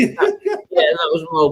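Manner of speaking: laughing. Real, not acted. Laughing yeah.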